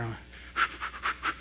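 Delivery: acted panting, words nearly lost; slow, soft puffing after running downstairs; out of breath. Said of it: "Because I'm out of breath running down the stairs".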